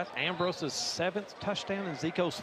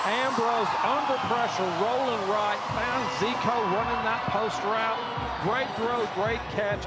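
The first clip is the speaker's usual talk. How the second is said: higher and louder recap; excited; 5 semitones higher, 4 dB louder than usual. Real, not acted. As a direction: keep the touchdown buzz going. Color man recaps the touchdown.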